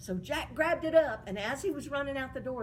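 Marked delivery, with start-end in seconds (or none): none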